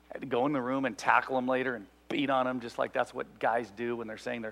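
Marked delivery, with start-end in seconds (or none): none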